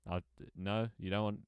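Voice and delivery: usual speaking voice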